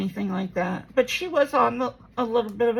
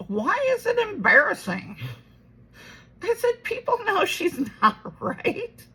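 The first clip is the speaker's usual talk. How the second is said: much higher, laughing in disbelief; incredulous laugh; 10 semitones higher, 4 dB louder than usual. Retold or imitated. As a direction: laugh it off at mom. Retells arguing with her mom.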